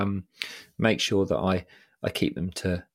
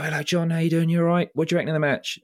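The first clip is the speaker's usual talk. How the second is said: put-on old boy at the pub; 8 semitones higher, faster than usual. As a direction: call out like a chirpy local regular. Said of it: "he'd go".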